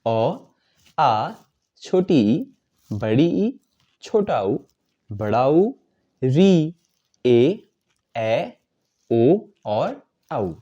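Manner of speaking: slow vowel chart recital; reciting the vowels. Shown as a demonstration. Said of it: "स्वर वर्ण में कौन कौन से वर्ण आते हैं".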